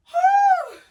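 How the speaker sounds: high, loud exhale of relief; loud breathless whew. Real, not acted. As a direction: blow out a tired whew. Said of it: "I'm out of breath".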